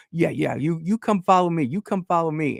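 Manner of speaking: high-pitched, urgent retold command; hurried cop. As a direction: imitate a cop urgently ushering someone along. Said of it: "looking behind really hurriedly".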